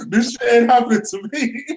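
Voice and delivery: high, laughing mock panic; quoting himself, laughing in disbelief. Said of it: "I was like".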